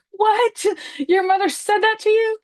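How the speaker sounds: high, shocked "What?" with loud disbelief; horrified disbelief. Retold or imitated. People hearing the story, aghast and incredulous. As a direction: gasp it out like a horrified listener. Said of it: "they're like".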